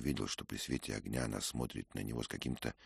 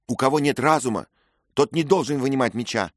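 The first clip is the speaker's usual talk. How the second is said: louder, quick scornful answer; curt retort; 13 semitones higher, 13 dB louder than usual. A narrator's reading. Lady answering Dick's excuse, quick and scornful. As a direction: cut him down briskly.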